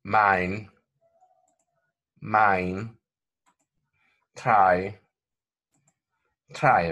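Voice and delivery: "Mine" and "Try" each said alone with a clear glide; model words. Shown as a demonstration. Teacher reading diphthong examples, careful. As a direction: drill "Mine", "Try" slowly.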